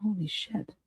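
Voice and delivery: breathless whisper of disbelief; stunned hushed whisper; whispered holy shit. Real, not acted. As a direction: barely breathe the words in shock.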